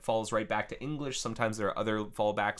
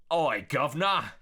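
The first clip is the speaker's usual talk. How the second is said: loud hammed-up Cockney call; over-the-top mock Cockney, pitched up; 5 semitones higher, 6 dB louder than usual. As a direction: shout it in terrible fake Cockney.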